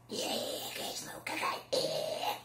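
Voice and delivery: rasping, voiceless creature growl; Gremlins. Acted. Prank on a call-centre caller, rasping Gremlin voice. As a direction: croak softly from the throat like a little monster. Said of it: "Io imitavo la voce dei Gremlins".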